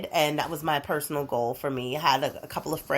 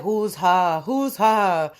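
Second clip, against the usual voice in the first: Oz townsfolk voice; 4 dB louder, much slower than usual. Acted. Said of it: "the line was like".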